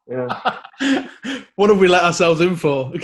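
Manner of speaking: high laugh, then yeah through it. Real, not acted. Host laughing at the MP's election tale, laughing. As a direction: laugh along at the story.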